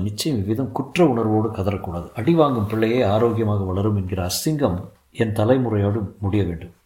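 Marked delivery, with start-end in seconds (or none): none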